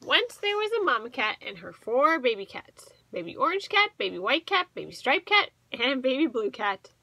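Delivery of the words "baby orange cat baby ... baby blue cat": ordinary delivery, nothing marked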